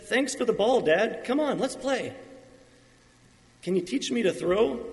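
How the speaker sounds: put-on high, eager kid asking to play; young son. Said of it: "As the child grows up".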